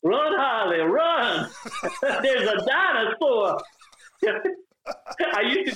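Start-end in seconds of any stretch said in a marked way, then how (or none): 0.0-1.5 high, hollered Southern twang; old Southern accent. Shown as a demonstration. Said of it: "With your old accent". 2.2-3.6 high, drawn-out Southern cry; old Southern accent. Shown as a demonstration. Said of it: "With your old accent".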